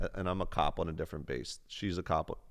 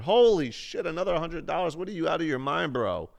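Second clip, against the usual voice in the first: much higher and louder, real excitement; real shock at a generous gift, bursting out; excited disbelief; 10 semitones higher, 6 dB louder than usual. Real, not acted. Streamer reacting to another hundred dollars from a viewer, thrilled.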